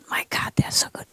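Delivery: hushed, breathy whisper; an awed, secretive whisper. Real, not acted. Confiding about the pets.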